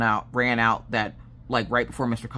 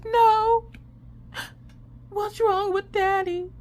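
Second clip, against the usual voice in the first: put-on much higher, slow child's voice; worried little girl; slower than usual. Daughter asking mom about dad, high worried child voice. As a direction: ask like a worried small child.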